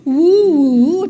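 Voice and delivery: loud, bright hooting woo; loud playful whoop at the crowd; excited woo. Real, not acted. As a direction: let out a rowdy whoop. Reacting to the vicious self-talk.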